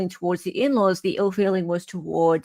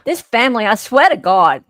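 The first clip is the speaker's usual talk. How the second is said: louder, exasperated "This family, I swear to God." with stress on "swear"; exasperated woman; 3 semitones higher, 7 dB louder than usual. Shown as a demonstration. Host shows how the wife would have said it, louder and exasperated. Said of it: "more like she would have said it".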